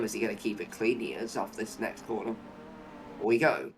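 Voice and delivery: normal talking voice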